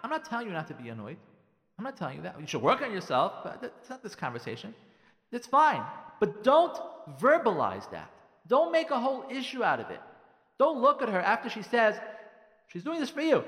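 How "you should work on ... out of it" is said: usual speaking voice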